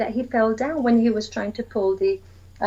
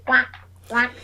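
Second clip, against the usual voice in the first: quacking like a duck. Shown as a demonstration. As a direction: do the duck for the children. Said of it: "you're creating that sound".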